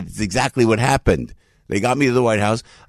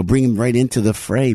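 ordinary talk, nothing marked

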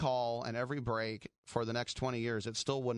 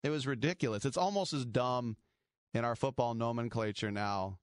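normal talking voice

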